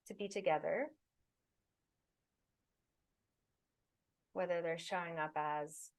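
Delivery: unhurried, soothing breathwork guidance; guided meditation. Acted. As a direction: guide them gently into the body and breath.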